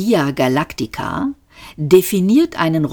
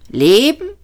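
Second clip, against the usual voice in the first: scornful echo; 7 semitones higher than usual. A narrator's reading. Robot scoffs at the word.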